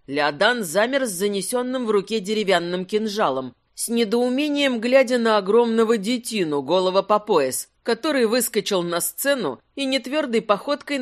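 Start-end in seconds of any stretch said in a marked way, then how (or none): none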